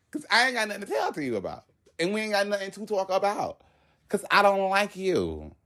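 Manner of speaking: high-pitched, defiant brush-off; defiant retort. Retold or imitated. Retold defiant answer to coworker.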